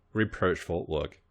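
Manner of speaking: ordinary delivery, nothing marked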